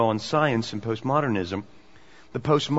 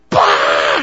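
sudden loud burst of mock alarm; bonkers outburst; 10 semitones lower, 10 dB louder than usual. Media reacting to a creationist candidate, going bonkers. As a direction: blurt one explosive noise, far louder than talk. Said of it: "They went bonkers on it".

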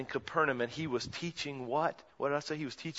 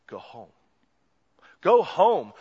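normal talking voice

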